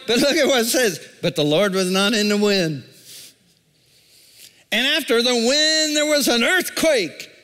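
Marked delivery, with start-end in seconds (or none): 4.7-7.3 preaching. Acted. Preaching the earthquake verse.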